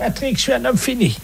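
high, wailing fake despair; mock anguish. Fake inspector to Patrick, hammed-up despair. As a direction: moan it like a ruined man, overacted.

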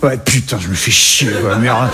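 put-on low, grumbling Parisian accent; mock Parisian grumble, low; accent parisien. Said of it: "C'est comme ça qu'il sonne le parisien". (accent parisien râleur et grave)

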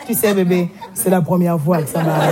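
low, sheepish mumble of a man caught out; sheepish lover's excuse. Retold or imitated. A man whispering an excuse to his girlfriend in bed, low and sheepish. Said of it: "à la fin, a fait".

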